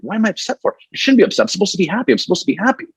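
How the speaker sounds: high, frustrated muttering at himself; frustrated self-talk. Retold or imitated. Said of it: "I'd be like".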